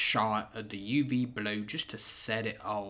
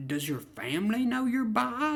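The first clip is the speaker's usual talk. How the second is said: surprised; 10 semitones higher than usual. Retold or imitated. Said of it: "I was like".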